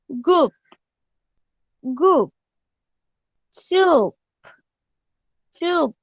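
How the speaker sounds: गुप and चुप said slowly with a short u at the end of the consonant; clear model reading. Shown as a demonstration. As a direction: read गुप, चुप syllable by syllable for the class. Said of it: "वो मैं अच्छे से पढ़ती हूँ". (गुप, चुप को साफ़ छोटी ऊ के साथ बोलना)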